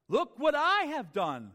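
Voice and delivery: slow, loud royal boast; proud king gloating over his city; boastful king. Acted.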